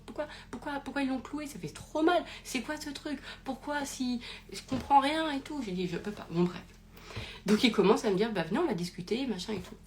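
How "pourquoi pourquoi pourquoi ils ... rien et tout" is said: anguished pleading. Retold or imitated. Retold anguish before the cross.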